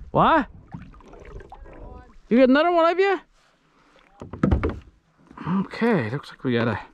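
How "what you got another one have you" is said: excited call-out. Real, not acted. Calling across to his fishing brother.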